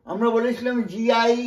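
ordinary delivery

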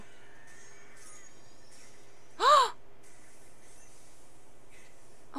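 short, soft gasp; real gasp. Real, not acted. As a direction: catch your breath at what you see. Reacting to a tense scene.

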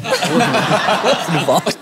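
laughing mock denial. Real, not acted.

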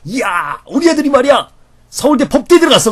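high, loud, proud boss bragging about his son; a proud boss, pitched high and loud; boastful boss. Retold or imitated. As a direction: show off the son's law school admission. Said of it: "직장 상사 부장님이".

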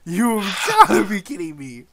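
laughing in disbelief; stunned, laughing shout; shocked laughter. Real, not acted. Rare pet drop on first task.

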